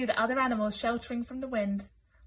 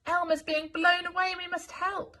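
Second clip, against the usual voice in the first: worried urging; 8 semitones higher, 4 dB louder than usual. Acted. Worried elephant urges rescue.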